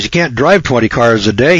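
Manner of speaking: ordinary talk, nothing marked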